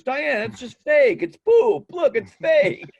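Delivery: high, loud, drawn-out mock pleading; frantic pleading dad. Dad confessing the prank to furious wife, frantic pleading. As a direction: imitate a desperate shouted explanation, stretching the words.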